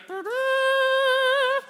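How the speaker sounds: high, loud sustained trumpet noise; imitated trumpet blast. Churchgoer blowing a trumpet at offering time, loud imitated fanfare. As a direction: toot a long mock fanfare with the voice. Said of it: "you had a little trumpet".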